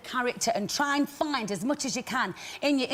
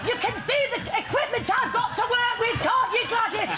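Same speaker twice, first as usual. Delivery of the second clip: mock-indignant, pitched up high; amused high pitch; 10 semitones higher than usual. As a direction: complain to Gladys in a high amused voice. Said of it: "And I said".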